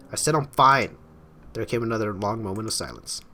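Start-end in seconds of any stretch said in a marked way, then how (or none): 0.1-1.0 louder, higher, insisting through the door; fed-up raised voice, falling on FINE; exasperated insistence. Acted. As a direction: snap it loudly to shut down the questions. Acted insistence through locked door.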